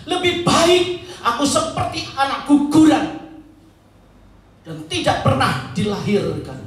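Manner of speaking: acted bitter outcry, starting high and loud; Ayub. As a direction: lament to God in mild anger, easing off. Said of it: "dengan mereka marah". (keluhan marah kepada Tuhan)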